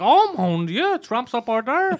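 high mock French-Canadian gasp of shock; shocked lady. Host retelling a coffee-shop reaction, high mock shock. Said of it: "So one lady got shocked".

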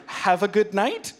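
"night" drawn out and rising like a question; imitated unsure sign-off, "night" swung upward. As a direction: say "Have a good night" slowly, lifting "night" into a question. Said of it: "He said it like a question".

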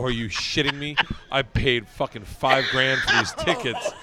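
loud, exasperated complaint; retold indignant outburst. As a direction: rant in loud disbelief. Retold outburst at missing the game.

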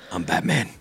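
low, soft gruff hero line; a hint of the famous Batman growl; Keaton Batman voice. Fan imitating an aging Batman actor, low and soft. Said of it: "do the voice".

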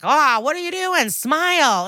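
sing-song mock catcall up high; mock heckling. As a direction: imitate construction workers catcalling. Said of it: "construction workers heckle her".

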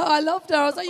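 usual speaking voice

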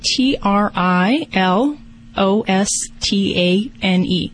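slow letter by letter spelling. Shown as a demonstration. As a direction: spell each letter out slowly. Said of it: "Can you spell that Trilostane for me".

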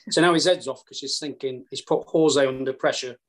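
usual speaking voice

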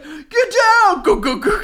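high, full-volume mock battle shout; frantic pretend screams, rapid-fire at the end; imitated screaming. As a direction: yell it like kids raising a false alarm. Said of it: "They would scream shit like".

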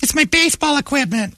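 put-on very high girly falsetto; innocent softball girl. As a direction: explain it in a little-girl voice. Softball girl at airport security.

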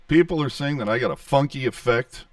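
hollow pipe-like audio; through a tube. Real, not acted. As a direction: voice echoing down a PVC pipe. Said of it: "Sound like I'm talking into a P V C pipe".

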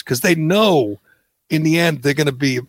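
usual speaking voice

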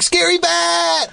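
mock spooky cry. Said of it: "And it's like".

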